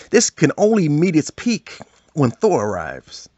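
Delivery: ordinary delivery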